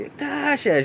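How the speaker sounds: high-pitched sing-song hello to Tasha; mock delighted greeting. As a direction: greet her like a long-lost friend. Mama greeting Tasha excitedly.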